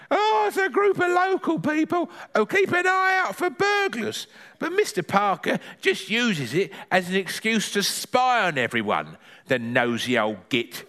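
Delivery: high granny voice dropping toward the end; high-pitched granny griping, sinking to a grumble; Granny figure, disapproving. Acted. Granny explains neighbourhood watch to grandson, high and disapproving.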